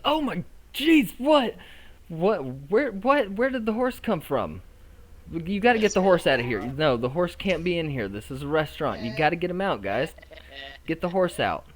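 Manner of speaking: put-on high, loud flustered teen host; startled outburst. Restaurant host finds a horse indoors, alarmed and shouting. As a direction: shout in shocked protest, pitch leaping up.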